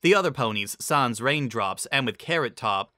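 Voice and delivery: usual speaking voice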